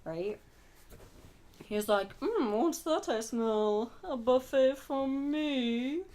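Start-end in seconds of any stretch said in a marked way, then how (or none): 2.2-6.0 slow, snooty monster savoring a meal; a hungry giant monster, posh and unhurried; snooty kaiju voice. Pretend. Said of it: "That's my kaiju voice".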